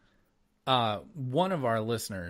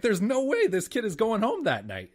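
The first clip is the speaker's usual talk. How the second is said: incredulous; 10 semitones higher, 5 dB louder than usual. Retold or imitated. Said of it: "I was like".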